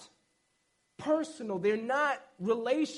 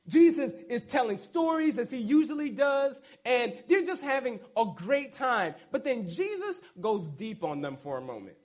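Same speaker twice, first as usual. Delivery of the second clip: normal talking voice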